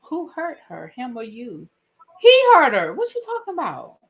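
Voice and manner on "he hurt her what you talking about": loud, high-pitched protest; indignant retort. Real, not acted. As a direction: fire back as if the answer is obvious. Indignant retort to who hurt her.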